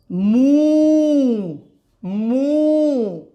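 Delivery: मू with a long held ū; long ū demo. Shown as a demonstration. Teacher showing students the long vowel in मू. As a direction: hold the ū in मू long and steady. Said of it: "तो sound बनेगा".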